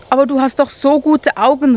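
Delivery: desperate cry. Acted. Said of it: "rief Marvin verzweifelt".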